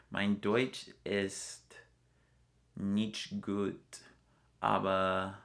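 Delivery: slow, deliberate German words; careful German. Shown as a demonstration. Traveler put on the spot speaks German slowly. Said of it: "Can you say anything?".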